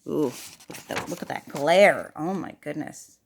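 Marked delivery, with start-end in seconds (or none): none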